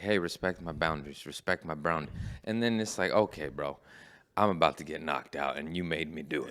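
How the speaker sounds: low, soft imagined warning; quietly acted last warning; restrained warning. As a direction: say it low, holding the temper down. Acts out restrained warning before fight.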